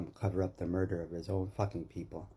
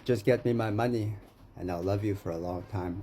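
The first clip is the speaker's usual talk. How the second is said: ordinary talk, nothing marked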